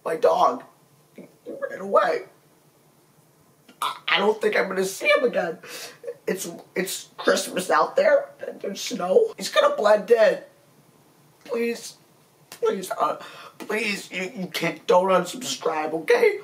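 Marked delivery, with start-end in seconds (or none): 1.2-5.2 high breathy fake crying; cracked falsetto wail; mock sob. Mock crying about lost dog. 11.4-16.4 high, cracking, hammed-up begging; whiny falsetto please; mock pleading. Begging fans not to unsubscribe.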